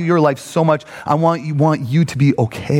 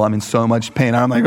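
ordinary talk, nothing marked

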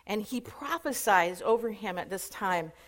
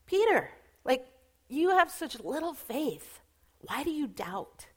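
mild reproach; 3 semitones higher, 3 dB quieter than usual. Acted. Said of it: "he kind of exposes him".

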